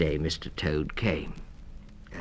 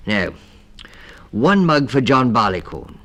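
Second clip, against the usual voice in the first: louder, thumping out the count; emphatic; 7 semitones higher, 12 dB louder than usual. A narrator's reading. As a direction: bang each word down like the mug. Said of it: "thumping a mug on the table".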